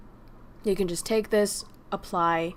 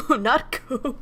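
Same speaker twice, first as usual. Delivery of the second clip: laughing; 10 semitones higher, 4 dB louder than usual. Real, not acted. Laughing protest.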